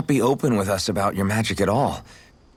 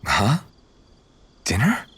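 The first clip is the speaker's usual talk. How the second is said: ordinary talk, nothing marked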